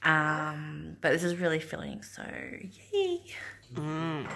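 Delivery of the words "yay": excited. Real, not acted. Small cheer.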